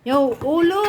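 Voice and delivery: ordinary delivery, nothing marked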